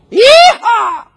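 high, loud cowboy whoop; exuberant yeehaw. Acted. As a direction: let out a big, free yeehaw. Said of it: "And we go".

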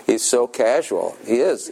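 normal talking voice

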